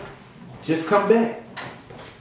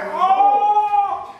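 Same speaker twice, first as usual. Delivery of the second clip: sudden high yelp of surprise; startled oh; 14 semitones higher than usual. Retold or imitated. Yelping in shock as the weave comes out. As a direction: yelp it like the weave just fell out.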